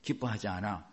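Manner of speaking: low, subdued muttered self-doubt; self-condemning thought. Acted. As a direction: voice a defeated inner thought. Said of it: "이 생각이 딱 들어와요".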